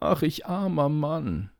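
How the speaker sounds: high, slow lament; crying out. A narrator's reading. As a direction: draw out the carter's cry of woe. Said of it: "rief er wieder".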